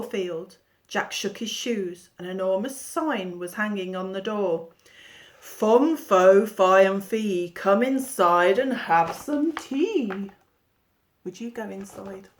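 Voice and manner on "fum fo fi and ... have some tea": loud, slow, booming chant; booming giant rhyme. A narrator's reading. Giant's sign on the door, read in a loud booming chant. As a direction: boom it out like a giant.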